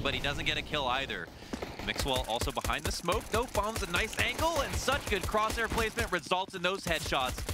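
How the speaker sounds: high-pitched excited esports call; live play-by-play. Real, not acted. Caster calling a round for viewers, excited play-by-play.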